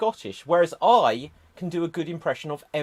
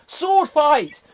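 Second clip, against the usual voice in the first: loud, high battle call; rousing shout; 11 semitones higher, 11 dB louder than usual. Acted. Starting a mock duel, rousing shout. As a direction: shout it like a pantomime challenge. Acting out a sword fight.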